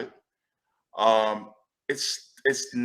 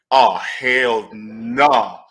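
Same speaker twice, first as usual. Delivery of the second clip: indignant refusal; 2 semitones lower, 8 dB louder, much slower than usual. Real, not acted. Reacting angrily to the story.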